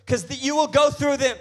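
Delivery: high, loud, impassioned sermon; full-force preaching. Real, not acted. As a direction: proclaim it with mounting pulpit fire.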